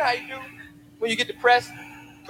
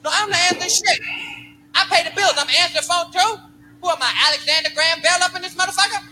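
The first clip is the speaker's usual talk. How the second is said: put-on slurred, belligerent drunk dad; drunk father; 8 semitones higher than usual. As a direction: rant like a drunk father at home. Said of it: "My pop's home like this drunk".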